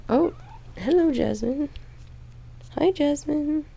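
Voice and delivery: high, sing-song hello to the kitty; cooing pet talk. Real, not acted. Vlogger greets her cat on camera, high cooing pet talk.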